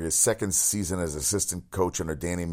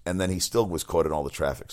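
ordinary talk

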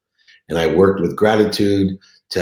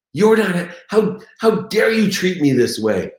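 indignant outburst; 8 semitones higher than usual. Retold or imitated. Husband lashing out at his wife who wants to leave, high furious outburst. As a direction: rant at her like she's ungrateful, how dare you. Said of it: "I'm like".

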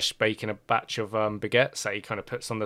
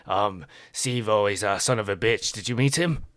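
Pierre; 5 dB louder than usual. Acted. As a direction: fuss over him like a doting host. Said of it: "and he's like".

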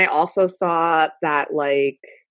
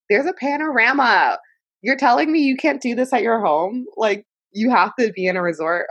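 high-pitched exasperated disbelief; incredulous mock protest; 7 semitones higher than usual. Host scoffing at rich people's island trips, incredulous.

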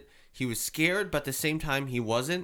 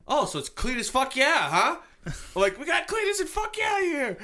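imitated hollering; 3 dB louder than usual. Re-enacting hollering out the names.

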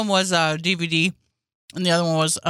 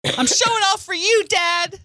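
mocking kid; 11 semitones higher than usual. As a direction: whine it like a smug child. Mocking a kid's retort.

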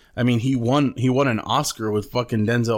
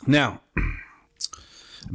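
whispering. Real, not acted. Whispered lead-in to movie topic.

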